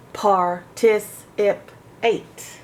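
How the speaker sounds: "Par, tis, ip, eight" as separate beats, rising in volume to "ip"; syllable split. Shown as a demonstration. Teacher splitting "participate" for pupils. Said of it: "makes it short sound".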